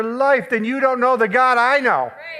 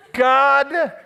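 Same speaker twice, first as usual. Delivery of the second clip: usual speaking voice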